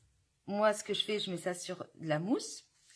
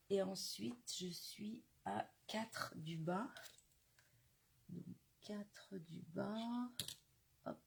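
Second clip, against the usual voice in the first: concentrated whisper; 9 dB quieter, slower than usual. Real, not acted. Measuring quietly while concentrating.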